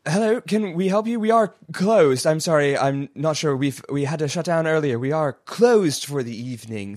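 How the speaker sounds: high, polite receptionist voice; polite security guard. Acted.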